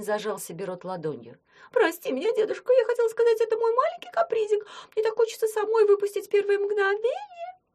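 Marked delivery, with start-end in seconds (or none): 1.7-7.6 much higher, louder sugary squeak; squeaky fake girl voice. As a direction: squeak an apology like a toy. Werewolf back to girl voice.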